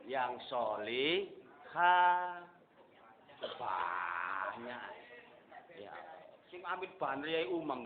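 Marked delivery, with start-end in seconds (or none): 0.1-2.5 drawn out. Retold or imitated. Stretched end of mock prayer. 3.4-4.9 crying shout. Real, not acted. Preacher mimics greedy crowd.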